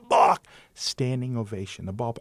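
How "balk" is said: loud, high, sudden umpire's call; shouted call. Retold or imitated. Umpire jumping in the air to call it, retold shout. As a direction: yell it out as one sharp call. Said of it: "I jumped in the air and I said".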